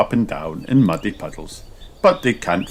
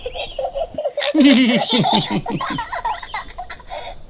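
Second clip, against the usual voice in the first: very high piggy giggles; high giggling piglets; children laughing. A narrator's reading. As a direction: squeal with kids' laughter. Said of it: "Laugh Peppa and George".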